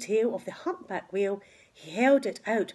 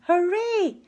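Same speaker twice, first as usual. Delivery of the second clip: much higher, louder cheer; shouting hooray; 10 semitones higher, 7 dB louder than usual. Acted. Villagers cheering the rescued whale, loud shout. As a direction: cheer as the whale floats free. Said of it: "villagers shouting".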